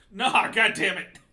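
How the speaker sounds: loud exasperated outburst; frustrated shout. Real, not acted. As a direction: yell in annoyance at the game. Game death frustration.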